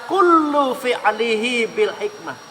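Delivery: slow, chanted quotation; Arabic recitation. Acted. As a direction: intone it like scripture. Quoting Arabic saying.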